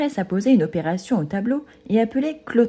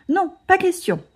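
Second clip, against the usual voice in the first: much higher, firm refusal; sharp refusal; 11 semitones higher than usual. A narrator's reading. Clotaire grabbing back his glasses, firm refusal. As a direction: cut it off flatly. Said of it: "trancha-t-il".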